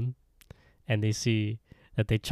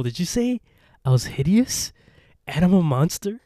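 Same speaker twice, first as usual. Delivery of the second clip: high, rising, affronted questions; retold indignation building in pieces; outraged disbelief; 7 semitones higher, 5 dB louder than usual. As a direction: act out scandalized disbelief, pitched way up. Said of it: "she loses her goddamn mind".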